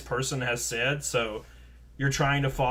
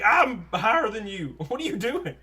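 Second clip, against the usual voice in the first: higher-pitched, half-laughing indignation; indignant comeback; 8 semitones higher, 3 dB louder than usual. Retold or imitated. Worker telling off the manager who yelled, indignant. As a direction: pull rank in mock disbelief. Retold comeback pulling rank.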